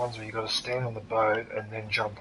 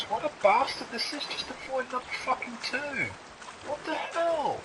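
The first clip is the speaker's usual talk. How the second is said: high-pitched frustrated whining; exasperated whine; 12 semitones higher, 4 dB quieter than usual. Real, not acted. Gamer exasperated at hidden number.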